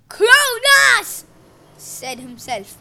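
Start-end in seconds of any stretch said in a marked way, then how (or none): 0.1-1.2 loud, high proud name; proud declaration. A narrator's reading. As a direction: announce it proudly. Cronus proudly names himself.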